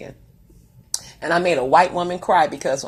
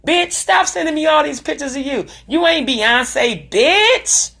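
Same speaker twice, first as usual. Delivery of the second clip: loud, high, sassy put-down; mock scolding; 10 semitones higher, 7 dB louder, slower than usual. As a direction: snap it out, drawn-out and loud. Telling off her sister.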